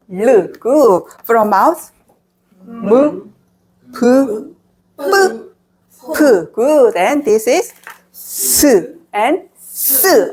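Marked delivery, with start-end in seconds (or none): 5.0-5.5 뿌 said tense and pitched high; tense Korean pp. Shown as a demonstration. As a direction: squeeze 뿌 out tight. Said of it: "Add more tension with a higher pitch".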